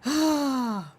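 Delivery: big voiced sigh. Real, not acted. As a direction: let out a long sigh. Exasperated after watching clip.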